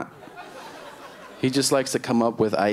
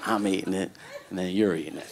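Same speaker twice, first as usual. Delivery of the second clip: softer, low-pitched acted complaint; subdued, lowered retelling of a complaint; hushed repeat; 4 semitones lower, 6 dB quieter, faster than usual. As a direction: say it low and under the voice. Mimics cousin's complaint back, hushed.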